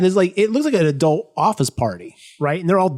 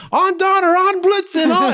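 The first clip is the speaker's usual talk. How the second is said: Santa calling reindeer. Retold or imitated. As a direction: cry out to the reindeer in a high Santa voice. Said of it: "he's like".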